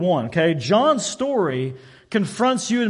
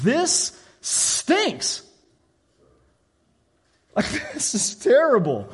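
higher, playful disgust; mock disgust; 5 semitones higher, slower than usual. Convert mocks the boring church, half-laughing disgust.

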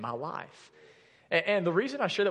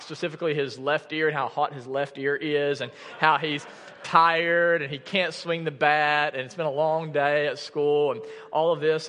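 usual speaking voice

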